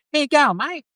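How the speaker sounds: high-pitched broad rural Aussie; broad Australian accent. Shown as a demonstration. Showing how country people greet a mate, broad Australian accent. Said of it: "it's like a broad Australian accent".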